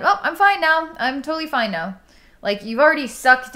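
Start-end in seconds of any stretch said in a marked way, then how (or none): 0.0-2.0 high-pitched mock reassurance; mock fine-now. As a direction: imitate someone insisting they've bounced back, pitched up. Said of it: "You're already showing me that".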